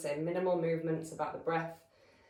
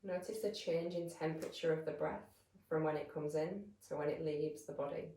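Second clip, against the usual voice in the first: calm, measured meditation voice with long pauses; guided meditation; 7 dB quieter than usual. Real, not acted. Yoga teacher settling listeners into breath awareness, calm guided meditation. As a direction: guide them gently into stillness, leaving space between phrases.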